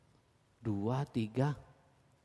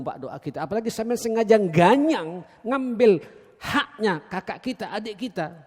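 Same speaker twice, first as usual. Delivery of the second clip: preaching; 5 semitones higher, 7 dB louder than usual. Acted. Preacher warning congregation about inheritance.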